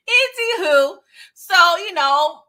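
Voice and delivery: giggly high anywho; a high, giggly anywho after the joke; laughing anywho. Real, not acted.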